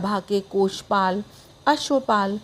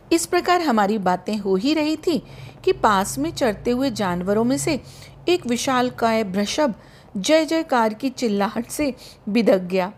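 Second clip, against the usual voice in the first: usual speaking voice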